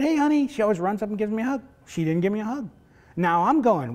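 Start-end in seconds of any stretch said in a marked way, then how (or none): 0.0-0.5 high, louder bright hello; cheery greeting. Retold or imitated. Father greeting daughter on coming home, cheerful and high. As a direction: call out happily to a child. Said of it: "I said".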